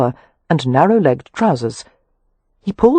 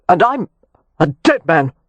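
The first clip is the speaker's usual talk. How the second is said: growled threat. A narrator's reading. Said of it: "Kev growled".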